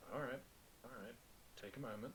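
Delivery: soft, settling reassurance; calming. Acted. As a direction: gently talk someone down. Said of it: "his left hand up and just says".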